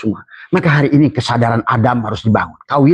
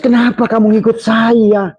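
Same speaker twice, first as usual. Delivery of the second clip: much higher, mocking devil voice; Iblis speaking; 10 semitones higher than usual. Preacher voicing Iblis mocking his followers, higher and taunting. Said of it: "Kata iblis".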